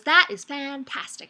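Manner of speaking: slow, drawn-out big-mouthed frog delight. Acted. Frog reacts to the cow's answer, big-mouthed. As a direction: stretch each word with a wide-open mouth. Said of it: "he speaks with a really big mouth".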